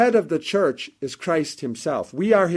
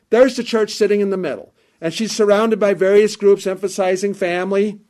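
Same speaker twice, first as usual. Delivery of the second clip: loud, raised preaching voice, high and driving; fiery preaching; 3 semitones higher, 4 dB louder than usual. Acted. Preacher warning the congregation about groups using the church, in fiery full-voiced preaching. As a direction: preach it hard from the pulpit.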